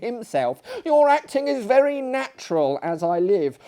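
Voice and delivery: put-on old lady chiding her kicking neighbour; indignant old lady. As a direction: tell him off like an offended old dame.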